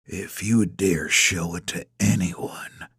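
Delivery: low, slow, soft threat; hushed, tightly held menace; through gritted teeth. A narrator's reading. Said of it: "He speaks through gritted teeth".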